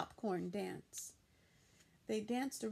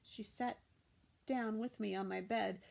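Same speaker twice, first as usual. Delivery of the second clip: ordinary delivery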